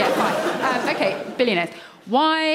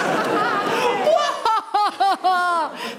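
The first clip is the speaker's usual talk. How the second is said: smug tycoon laugh; a rich villain's gloating laugh; billionaire laugh; 9 semitones higher than usual. Pretend. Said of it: "That's my billionaire laugh".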